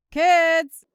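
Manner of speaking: put-on loud call to the kids. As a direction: call the kids from another room. Mom calls kids from the phone.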